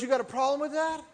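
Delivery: imitating the heckler's loud challenge; mock challenge. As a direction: throw it at him like a drunk picking a fight. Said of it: "And he goes".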